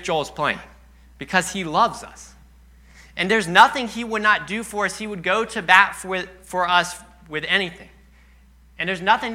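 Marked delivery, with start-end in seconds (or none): none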